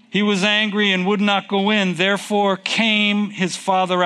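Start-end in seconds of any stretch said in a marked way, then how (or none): none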